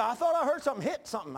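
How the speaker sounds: high, loud concerned call; wife sure she heard a crash. Retold or imitated. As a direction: press him from the doorway. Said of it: "She said".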